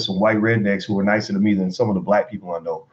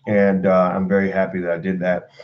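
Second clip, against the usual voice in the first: usual speaking voice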